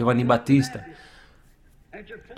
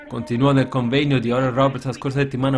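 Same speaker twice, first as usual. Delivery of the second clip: normal talking voice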